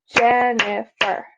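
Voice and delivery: Jennifer split into three beats, Jen-ni-fer; syllable by syllable. Shown as a demonstration. Teacher using sister's full name, Jennifer syllable by syllable. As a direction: clap out Jennifer, each syllable separate. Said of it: "She has three syllables".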